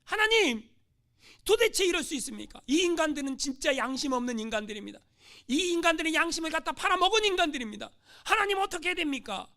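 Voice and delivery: loud, high outraged plea; missionary complains to God, loud and outraged; angry complaint to God. Acted. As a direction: vent your fury at God. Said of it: "너무 화가 났어요".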